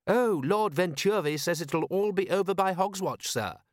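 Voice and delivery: lightly voiced proper butler; a hint of stiff, eager manservant; prim butler voice. Butler character voice.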